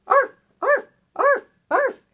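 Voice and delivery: very high, short yappy arfs; high-pitched puppy barks; dog bark. Acted. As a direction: yap like a small dog. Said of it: "Is some animal that goes".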